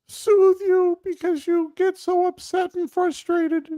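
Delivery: very high, sweet pet voice; dog's voice. Pretend. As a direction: speak as the dog, squeaky and fast. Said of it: "what he would say is".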